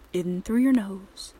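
ordinary talk, nothing marked

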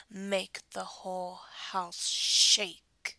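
slow, forceful order; loud, drawn-out command. Pretend. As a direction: build loudly into "shake".

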